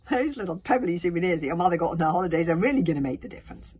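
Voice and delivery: loud, fast, scornful sarcasm; sarcastic. A narrator's reading. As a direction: pour scorn with mock enthusiasm. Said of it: "said Fishlegs sarcastically".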